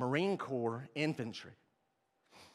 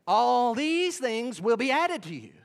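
slow, higher and louder proclamation; proclaiming scripture; 7 semitones higher, 6 dB louder, slower than usual. Acted. Preacher answering his own question, slow proclaiming. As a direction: ring it out slowly. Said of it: "and then what?".

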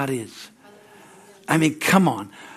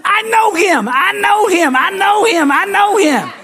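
high, loud screamed outburst imitating Buddy; excited screaming; 6 dB louder than usual. Preacher acts out Buddy thrilled Santa's coming, screaming. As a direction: shout it over and over, beside yourself with excitement. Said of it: "He's screaming".

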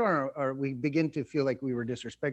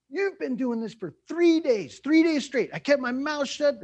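mock angry complaint; 9 semitones higher, 4 dB louder than usual. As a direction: nag like someone who kept score. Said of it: "The alternative is".

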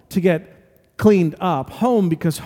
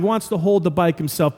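normal talking voice

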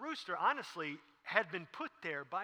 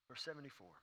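ordinary talk, nothing marked

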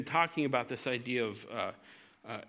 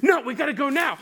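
louder and higher, urgent; impatient frontal lobe; 10 semitones higher, 8 dB louder, faster than usual. Acted. Frontal lobe overruling its own caution, loud and urgent. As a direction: snap back to rushing. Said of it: "the frontal lobe goes".

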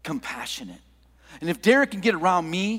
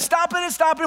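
mock panic; 11 semitones higher, 3 dB louder than usual. Retold panic during backup.

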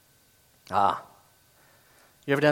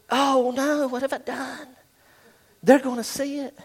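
mock panic; 10 semitones higher than usual. Overspender panics after shopping.

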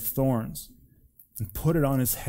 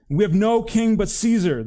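high, loud defiant answer; raised, emphatic narrated declaration; crowd retort; 9 semitones higher, 7 dB louder than usual. A narrator's reading. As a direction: shout it back as one crowd. Priests answer back amid the shouting.

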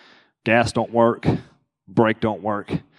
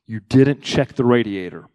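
self-scolding; slower than usual. Retold or imitated. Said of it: "I went".

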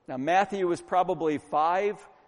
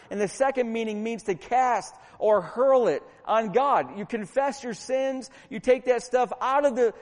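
higher, driving sermon delivery; forceful preaching; 6 semitones higher, 5 dB louder than usual. Real, not acted. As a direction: preach it hard, punching the key words.

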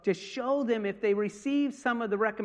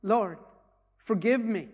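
crying out; 2 semitones higher, 7 dB louder than usual. Retold or imitated. The dying man crying out to the Lord, louder. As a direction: voice the man's plea, a touch of tears. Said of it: "He's, he's crying".

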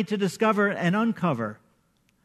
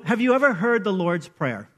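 usual speaking voice

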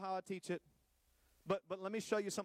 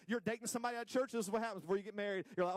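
ordinary talk

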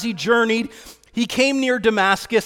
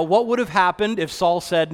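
ordinary delivery

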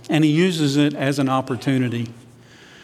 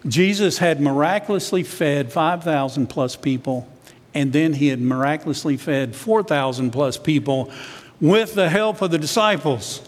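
ordinary talk

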